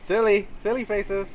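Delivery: high, playful photo coaxing. Real, not acted. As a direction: coax a silly pose in a high voice. Posing kid for photos.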